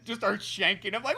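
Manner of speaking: usual speaking voice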